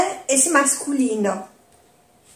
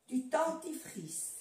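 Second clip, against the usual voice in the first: ordinary delivery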